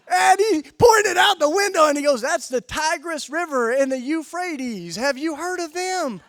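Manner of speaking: very high, very loud shout; impassioned shouted retelling. Retold or imitated. Bishop points out the window to correct the visitor, shouting.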